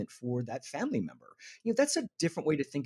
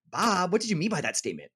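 pointed, blaming question, pitch higher; accusatory tone; 6 semitones higher, 4 dB louder than usual. Shown as a demonstration. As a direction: put Bob on the spot with blame in the voice. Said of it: "that tone is accusatory".

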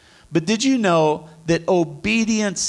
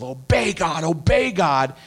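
chanted imitation of religious scolding; lightly mocking, insistent repetition; mock preachy nagging. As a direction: parrot it like a rote command. Mimics high-horse religious nagging.

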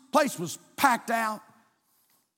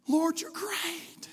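slow, soft, higher Lord, you're great; soft spoken praise; 6 semitones higher, 5 dB quieter, much slower than usual. Shown as a demonstration. Pastor modelling spoken praise, softly. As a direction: breathe the praise gently. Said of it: "I'm supposed to speak it".

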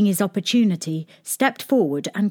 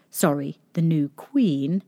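ordinary delivery, nothing marked

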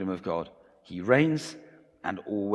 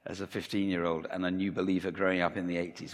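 ordinary talk

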